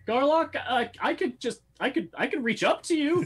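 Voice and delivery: very high put-on character voice; concerned helper. Passenger at the window offers the dangling goblin help, high hesitant voice.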